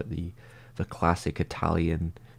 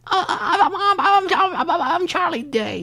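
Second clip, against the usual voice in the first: much higher, stammering neurotic voice; Charlie Day impression; 4 dB louder, slower than usual. Streamer to chat, mimicking the actor, high nervous stammer. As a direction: squeak it out, stuck on I'm. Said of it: "his voice is really high".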